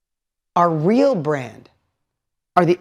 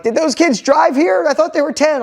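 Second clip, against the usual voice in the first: high-pitched mock parent disbelief; silly parent talk; 9 semitones higher, 4 dB louder than usual. Parents gawking at the other kids' team, mock-incredulous. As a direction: play an incredulous sideline parent, pitched well up. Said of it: "All the parents are saying silly stuff".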